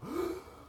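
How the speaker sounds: a sudden high gasp of waking shock; sharp gasp. Retold or imitated. Retelling waking up at the mirror, a sharp gasp. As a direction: gasp in hard like the air just hit you. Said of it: "I go to the mirror ... and I go".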